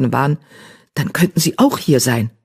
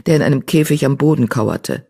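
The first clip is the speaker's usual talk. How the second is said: ordinary delivery, nothing marked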